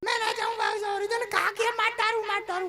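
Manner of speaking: high, half-laughing mock fright; frightened old woman. Old woman tells her neighbour her fear, high mock wail. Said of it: "मैं तो डर के मारे".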